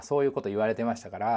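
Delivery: ordinary delivery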